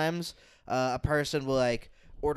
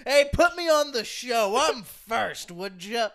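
higher and louder, unhinged and pushy; crazy guy voice; 6 semitones higher, 6 dB louder, slower than usual. Pretend. Imagined crazy man in a bad shirt demanding stage time. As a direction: barge in demanding a slot.

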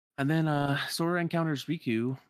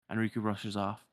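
usual speaking voice

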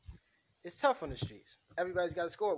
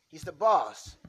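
louder, hammering the word boss; emphatic; 8 dB louder, slower than usual. Acted. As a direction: drive the point home hard. Insisting to Angelo.

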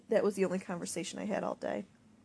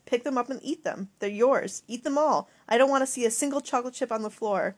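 higher, louder furious orders; angry yelling; 4 semitones higher, 5 dB louder than usual. Acted. Mom forcing daughter to eat spilled chips, angry yelling. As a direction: bark the commands down at her. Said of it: "I don't know why she was yelling".

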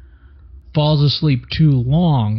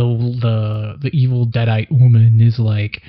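ordinary delivery

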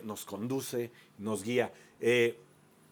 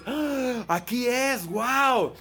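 amazed shout; 11 semitones higher, 6 dB louder, much slower than usual. Retold or imitated. Wedding guests discover the clear sky, amazed shout. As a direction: gasp then high, loud exclamation.

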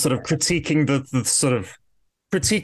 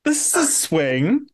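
stunned reaction; 10 semitones higher, much slower than usual. Retold or imitated. Mimicking her stunned face at screen.

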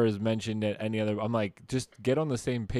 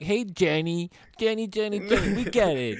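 high, mock-exasperated boy repeating Jenny; lightly voiced fed-up kid, higher; 6 semitones higher than usual. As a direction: whine the name over and over like the kid. Mocking the boy in the film.

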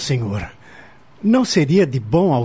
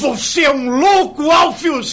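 angry shout; 10 semitones higher than usual. Acted. Counselor defies lord while arrested.

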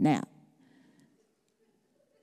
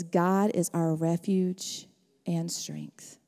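slow, hushed whisper; 6 semitones lower, 7 dB quieter, slower than usual. Acted. Pastor reading the psalm to the church, slow whisper. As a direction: breathe the verse reverently.

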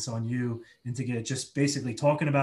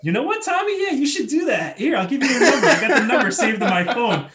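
high, loud mock-eager voice; mock-encouraging buddy, high and loud; teasing Jordan; 11 semitones higher, 10 dB louder than usual. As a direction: ham up the friend egging him on. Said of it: "they're like".

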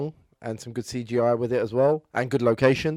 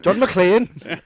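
put-on louder, higher, botched Scottish accent; accidental Scottish accent; 5 semitones higher, 5 dB louder than usual. Co-host doing a film hero's name, unintentionally Scottish accent. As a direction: attempt an accent that comes out Scottish. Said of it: "why are you Scottish".

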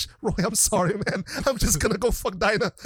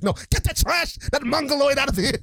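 higher, sneering dismissal; mock disgusted Lex; 7 semitones higher than usual. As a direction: wave him off with snooty contempt. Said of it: "Lex is just like".

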